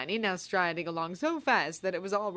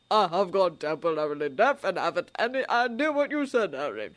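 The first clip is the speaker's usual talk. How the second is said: put-on louder, gently teasing old man; old wizard voice; 4 dB louder than usual. A narrator's reading. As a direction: tease kindly. Dumbledore joking.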